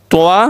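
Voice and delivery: "তোয়া" ending in an open "wa"; incorrect w-glide. Shown as a demonstration. Teacher repeating the faulty form for students, "তোয়া" with "-wa". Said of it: "অশুদ্ধ".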